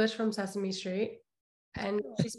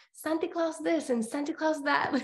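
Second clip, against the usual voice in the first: put-on higher-pitched little kid with a Filipino accent; childhood Filipino accent; 7 semitones higher than usual. Little Queens girl at Christmas, Filipino accent. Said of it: "I had an accent and everything".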